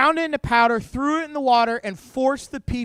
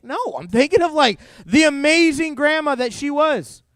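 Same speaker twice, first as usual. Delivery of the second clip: higher, louder exasperated comeback; indignant retort; 6 semitones higher, 5 dB louder than usual. Acted. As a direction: push back hard at a clueless consoler.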